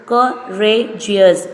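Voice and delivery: "courageous" drawn out beat by beat; slow syllable by syllable. Shown as a demonstration. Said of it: "three syllable".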